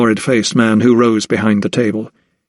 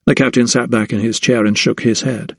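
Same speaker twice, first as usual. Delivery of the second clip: plain reading voice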